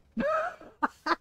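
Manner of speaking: high-pitched burst of laughter; laughing. Real, not acted. Storyteller cracking up at the family showdown, high laugh.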